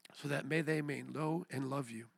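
soft, subdued prayer of repentance; low-voiced plea to God; solemn prayer. Real, not acted. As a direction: pray quietly, with long pauses. Prayer continues.

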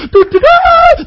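very high, loud sung trumpet call; imitated trumpet blast. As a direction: blare it out like a trumpet.

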